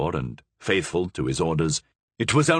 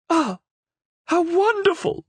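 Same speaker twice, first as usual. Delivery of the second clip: much higher, louder joyful cry; delighted cry; 4 dB louder than usual. Acted. Queen thrilled by the parrot, high delighted cry. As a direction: burst out in delight. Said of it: "cried the Queen".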